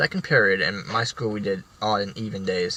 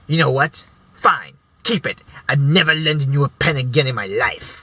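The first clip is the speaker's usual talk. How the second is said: louder, higher huffy dismissal; huffy give-up; 3 semitones higher, 5 dB louder than usual. Retold or imitated. Teacher huffily giving up the pen argument, louder and higher. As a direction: storm off in a snit.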